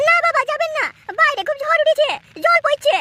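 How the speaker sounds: put-on high, pleading young wife's voice; worried daughter-in-law. Daughter-in-law begs her father-in-law not to leave in the rain, high and worried. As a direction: beg him high not to go out. Bride begs him to stay.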